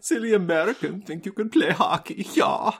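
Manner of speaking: high, hammed-up pseudo-German villain accent; mock the foreign villain in a bad, vague European accent; fake Eastern European accent. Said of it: "some sort of Eastern European dialect".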